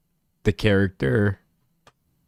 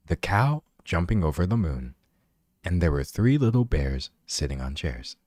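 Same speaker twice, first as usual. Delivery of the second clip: low, soft bedtime-story narrator; hushed, low storybook reading; audiobook voice; 7 semitones lower, 4 dB quieter than usual. Shown as a demonstration. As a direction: read it like a chill audiobook narrator. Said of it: "I can literally do the audiobook voice".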